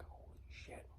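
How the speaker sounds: breathless awed whisper; stunned whisper at the mess; whispered holy shit. Retold or imitated. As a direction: hush it out in disbelief. Aghast at pile of parts.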